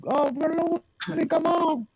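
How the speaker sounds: high, soft mock fan complaint; whining sports fan. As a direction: imitate a fan moaning from the stands. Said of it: "like a fan in the, in the stands going".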